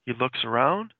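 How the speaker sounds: normal talking voice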